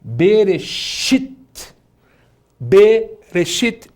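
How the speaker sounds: Bereshit very slowly, rising at the end; lingering on the long i in Bereshit; teacher showing the long vowel in Bereshit; slow emphatic reading. Shown as a demonstration. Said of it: "É um I longuíssimo".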